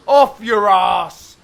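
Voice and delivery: slow, loud punchline call; drawn-out shout. Real, not acted. Commentator rhyming on his shot call, drawn-out shout. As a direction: stretch each word and belt it out.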